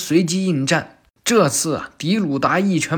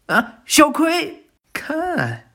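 much higher, slowed gasp of alarm; alarmed cry; 11 semitones higher, much slower than usual. Acted. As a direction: cry out as the little girl flies up. Himawari thrown into the air.

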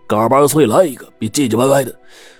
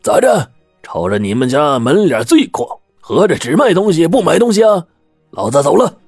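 put-on much higher and louder, indignant old bruiser; glaring outburst; 8 semitones higher, 3 dB louder than usual. Old man glaring at the shopkeeper, loud and indignant. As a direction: bark it out like a ruffled old tough. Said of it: "老炮儿俩眼一瞪".

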